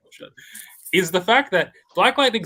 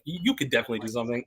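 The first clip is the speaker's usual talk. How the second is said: rushed; 2 semitones lower, 5 dB quieter, much faster than usual. Retold or imitated. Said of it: "he's like".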